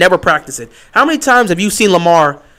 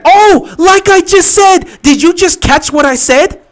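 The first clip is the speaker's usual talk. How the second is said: high, loud, slowed and triumphant; vindicated shout; 10 semitones higher, 6 dB louder, slower than usual. Real, not acted. Fan whose prediction just came true, shouting in vindication. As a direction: shout it like you just called it. Play confirms his prediction.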